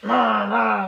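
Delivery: loud demon-baby impression in a deep voice; mock deep voice. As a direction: boom it out like a monstrous baby. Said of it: "like, deep voice".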